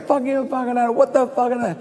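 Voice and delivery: high, garbled stream of abuse; sweary heckler. Retold or imitated. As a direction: imitate a passer-by ranting obscenities. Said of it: "there's a lot of swearing".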